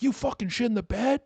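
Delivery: high-pitched outraged accusation; accosting. Acted. Boyfriend confronting sleeping girlfriend the wrong way, accosting her. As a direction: confront them in a squawking high voice. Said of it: "you accosting her".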